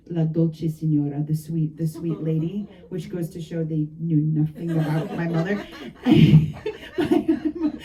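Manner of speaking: ordinary talk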